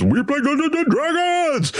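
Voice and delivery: high, slow excited cheer; group cheer. Retold or imitated. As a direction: cheer it out like an eager crowd. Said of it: "And everybody goes".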